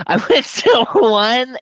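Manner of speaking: laughing. Real, not acted.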